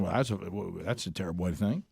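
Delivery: low, soft, quick scornful voice; mocking. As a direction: sneer it out quietly and fast. Mocking the new attitude.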